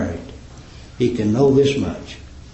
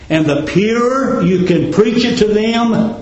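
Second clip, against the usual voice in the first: preaching climax; 7 semitones higher than usual. Acted.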